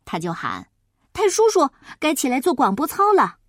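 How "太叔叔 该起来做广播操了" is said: calling out. Acted. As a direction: shout him awake. Boy shouts wake-up call.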